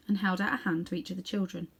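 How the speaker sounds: ordinary delivery, nothing marked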